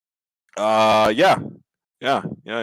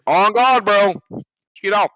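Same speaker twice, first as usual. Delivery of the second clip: louder, higher insistence; emphatic; 7 semitones higher, 4 dB louder than usual. Real, not acted. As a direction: insist on it loudly. Repeating a joke.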